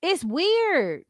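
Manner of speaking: normal talking voice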